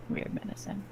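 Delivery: put-on breathy whispered ghost girl voice; eerie little ghost whisper. Shown as a demonstration. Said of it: "do the ghost girl's voice when you say weird".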